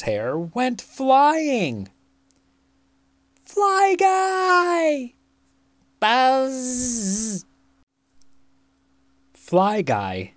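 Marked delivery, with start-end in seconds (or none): 3.4-5.1 very high, drawn-out cry; high-pitched exclaiming call. A narrator's reading. Family cries out at the fly. 6.0-7.4 high, long buzzed call; high-pitched insect buzz; buzzing fly voice. A narrator's reading. Fly calls its boy's name.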